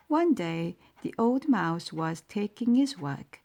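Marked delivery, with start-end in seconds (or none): none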